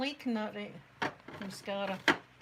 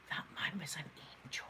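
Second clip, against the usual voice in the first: whispered awe; 5 semitones lower, 9 dB quieter than usual. Retold or imitated. Awed thought about her rescuer.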